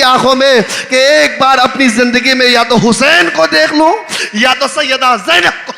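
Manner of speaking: loud, high impassioned sermon voice; loud majlis preaching of Zainab's words; preaching at full force. Acted. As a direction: call out Zainab's words to the crowd. Preacher voices Fizza's wish, shouting.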